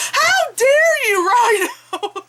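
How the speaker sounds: put-on very high, drawn-out little-kid outrage; indignant kid impression. Vlogger mimics kid behind them scolding Rhino, indignant kid impression. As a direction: imitate a child scolding a villain, slow and high. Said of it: "and the kid goes".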